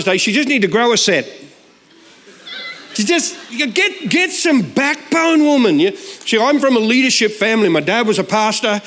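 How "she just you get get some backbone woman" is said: higher, slow, exasperated jab; husband scolding his timid wife, exasperated; mock exasperation. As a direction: push her to toughen up, mockingly. Mocking his wife's meekness.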